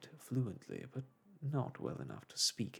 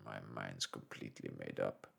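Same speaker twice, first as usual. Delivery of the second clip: much lower, softer gruff sailor voice; stubborn harpooner; 6 dB quieter than usual. A narrator's reading. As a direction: dig in stubbornly. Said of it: "replied Ned Land".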